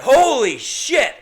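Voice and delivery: blurted out high and loud; loud exclamation. Retold or imitated. At baggage claim, spotting his lost bag: loud shocked blurt. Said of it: "out loud".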